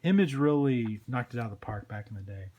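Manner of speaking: plain reading voice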